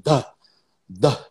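isolated drill syllables with pauses between; imitating tape instructor. Teacher imitating the man on the cassette, drill-like repetition. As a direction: lightly mimic a pronunciation tape. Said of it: "o cara falando assim".

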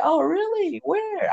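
high-pitched, playful questioning; mimicked woman's voice. Woman answers his claim to find her, high mimicked voice.

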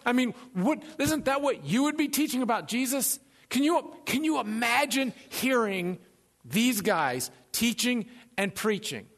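normal talking voice

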